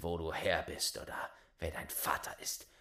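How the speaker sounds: hushed, secretive whisper. Acted. Father whispering to hide who the boy's father is. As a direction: whisper it in shame.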